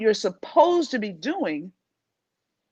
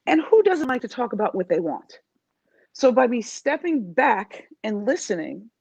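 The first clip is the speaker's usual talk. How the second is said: ordinary talk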